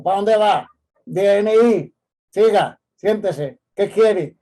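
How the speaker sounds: loud, curt orders like a prison guard; loud rude doorman imitation; policeman tone. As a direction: bark at the visitor, hammed-up rude. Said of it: "no hable como un policía".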